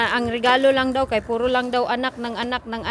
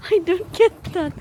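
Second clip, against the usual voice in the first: higher voice caught up in laughter; amused laughing; 5 semitones higher than usual. Real, not acted. Parent laughing at a child's octopus remark, amused. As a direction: ask through real giggles, pitched up. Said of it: "Natawa man ako dyan".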